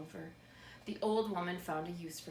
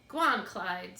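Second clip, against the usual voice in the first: put-on louder old woman urging; 8 dB louder, slower than usual. A narrator's reading. Old woman urging her scarecrow rabbit, louder. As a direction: egg him on like an old woman. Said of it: "said the woman".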